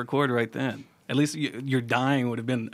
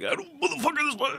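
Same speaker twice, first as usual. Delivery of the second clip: high, garbled mock-furious outburst; mock snapping rage; 14 semitones higher, 5 dB quieter than usual. Co-host playing a fighter who snaps, in high mock rage. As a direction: pretend to lose it and strangle someone. Mimics someone snapping and strangling.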